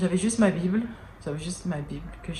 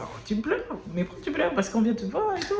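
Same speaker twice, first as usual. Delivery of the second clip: higher-pitched voice imitating her kids; imitated children; 8 semitones higher than usual. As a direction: play puzzled children questioning their mum. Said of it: "parce qu'ils faisaient". (voix aiguë imitant des enfants étonnés)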